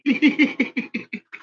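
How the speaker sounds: high burst of laughter. Real, not acted. Laughing at the microchip joke, high laugh.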